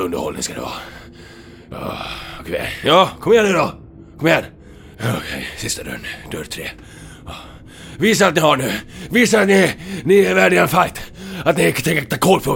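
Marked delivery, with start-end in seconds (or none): 2.8-4.4 louder and higher, daring them; a fired-up taunting shout; shouted challenge. Acted. Hero shouts challenge at enemies. 8.0-12.5 louder and higher, demanding a fight; fired-up yell to prove themselves; shouted challenge. Acted. Hero shouts challenge at enemies.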